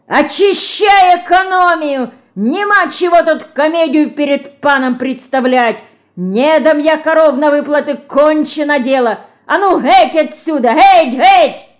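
high, loud, hoarse-edged roar; frantic yell. Acted. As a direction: scream them out of the yard. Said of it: "истошно заорал". (истошный крик, громко и высоко)

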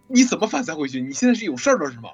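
normal talking voice

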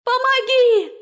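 high, loud ringing plea for help; tearful frightened cry. A narrator's reading. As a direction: beg through frightened tears. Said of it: "звенящий испуганными слезами голос".

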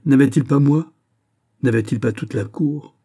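loud indignant question, then settling; stung indignation. A narrator's reading. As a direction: demand it, hurt and proud. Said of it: "la princesse ulcérée".

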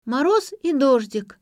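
high, loud, slow recitation; child reciting a poem. A narrator's reading. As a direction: chant it out like a child memorizing verse. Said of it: "Лера пыталась выучить стихотворение".

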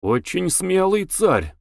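understated envious delight; joyful, envious admiration. A narrator's reading. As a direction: say it gladly, drawing it out. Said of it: "сказал радостно и как бы с завистью".

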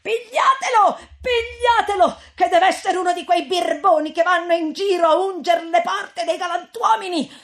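woman at the window yells to catch him; shouting. A narrator's reading. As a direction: scream for the crowd to grab him. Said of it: "gridava anche lei".

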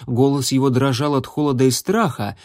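usual speaking voice

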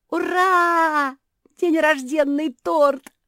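put-on very high, loud childlike cheer; excited yell. A narrator's reading. Мафи cheers for the birthday cake, yelling high. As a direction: whoop with joy, stretching the words. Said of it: "завопила Мафи".

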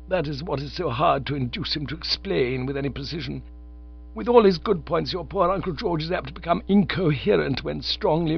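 a lightly voiced stern, formal aunt; Aunt Agatha's voice. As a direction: read it as a prim aunt laying down the law. Aunt Agatha explains the burglary.

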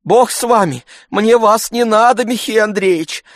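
high-pitched female voice; woman's rebuke. A narrator's reading. Agafya Matveevna rejecting Tarantyev, high-voiced rebuke. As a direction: rebuff him in a high woman's voice.

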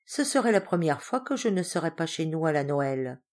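usual speaking voice

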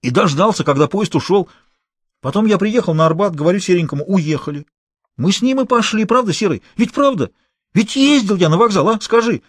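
a scared small-time crook blurting it out; a cornered accomplice answering the gang boss, high and nervous; frightened petty thief. A narrator's reading.

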